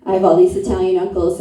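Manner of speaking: ordinary talk, nothing marked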